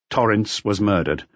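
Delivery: usual speaking voice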